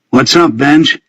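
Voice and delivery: casual, cool greeting; trying to sound cool. A narrator's reading. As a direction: play it as coolly as you can. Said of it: "as coolly as I could".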